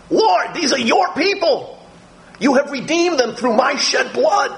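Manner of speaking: intercede earnestly for his people; Jesus pleading before the Father; interceding. Acted. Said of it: "Jesus says to God the Father".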